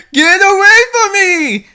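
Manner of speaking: high, loud panicked cry; terrified cry. Acted. Girl being carried off cries out in terror. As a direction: scream for her life as she's carried off.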